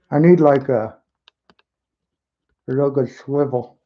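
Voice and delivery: talking around a paintbrush held in the teeth; mumbled with a brush between the lips; paintbrush in mouth. Real, not acted. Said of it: "trying to say with the paintbrush in my mouth".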